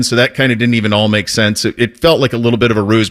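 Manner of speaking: normal talking voice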